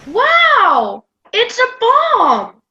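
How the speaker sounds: put-on high, thrilled child's exclamation; awed and excited, pitch leaping up; excited amazement. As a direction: burst out in wide-eyed wonder. Child thrilled by sound effect.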